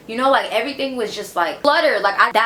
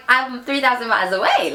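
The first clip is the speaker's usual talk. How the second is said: ordinary talk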